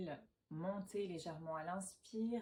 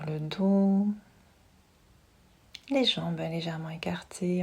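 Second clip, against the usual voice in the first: guided meditation; 14 dB louder than usual. Acted. Yoga teacher settling listeners lying down with eyes closed, hushed meditation voice. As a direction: guide them gently into relaxation, unhurried and close.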